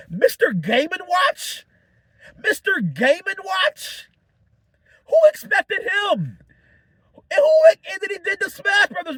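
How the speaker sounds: high, slow, stretched-out disbelieving question; can't believe who just showed up; rising amazed squeal; excited disbelief. Real, not acted. Hyped about a surprise cameo.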